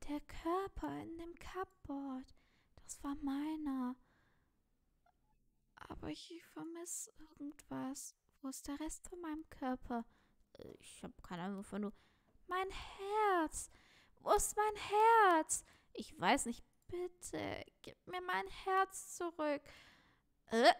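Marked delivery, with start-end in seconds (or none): 0.1-10.0 plaintive, searching, pitched up high; forlorn game figure. Acted. 12.5-15.6 high, drawn-out wail of words; anguished high character voice, building louder; desperate game figure. Acted. Figure desperately seeks its stolen heart. 16.9-19.6 high, plaintive character voice; high-pitched acted plea; pleading game figure. Figure begs for its heart back.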